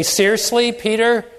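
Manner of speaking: high, loud exasperated rebuke; an exasperated told-you-so, high and loud; scolding Jesus. Pretend. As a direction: scold him like a disappointed teacher. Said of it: "That's maybe how I would respond".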